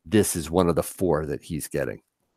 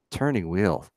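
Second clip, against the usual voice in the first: ordinary delivery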